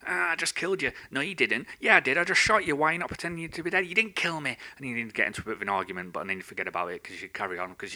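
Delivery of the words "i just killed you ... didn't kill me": put-on kids arguing. Acted kids' quarrel over guns.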